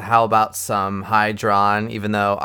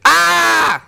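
short high, loud scream; 8 dB louder than usual. Shown as a demonstration. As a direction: scream out loud to spike the level. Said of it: "I'd scream really loud".

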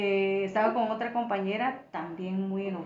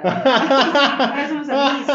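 loud, higher words through a laugh; 5 semitones higher, 13 dB louder, slower than usual. Real, not acted. Guest joking with host about the former companion, laughing. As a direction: laugh heartily while saying it.

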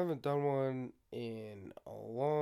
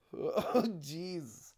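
ordinary talk

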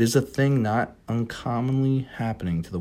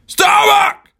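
calling out; 12 dB louder than usual. Acted. Ahab hails mate from boat.

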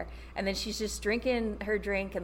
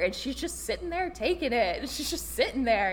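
ordinary talk

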